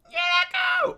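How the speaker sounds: very high squeak; squeaky yes. Acted. Tiny creature agreeing to leave. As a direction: chirp it out tiny.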